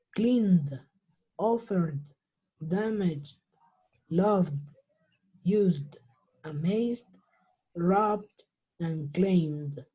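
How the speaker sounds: "cleaned" through "claimed" each closing on D, no extra E; word by word, voiced D ending "cleaned" to "claimed"; final D sound. Shown as a demonstration. Teacher models example list, "cleaned" to "claimed" ending in D. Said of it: "Con esa D al final".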